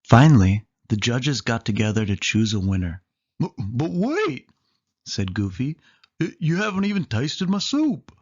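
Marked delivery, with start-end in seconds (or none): 3.4-4.5 much higher, slow stammering goofy. Acted. Goofy pleads with judges. 6.2-8.1 much higher stammering goofy. Acted. Goofy pleads with judges.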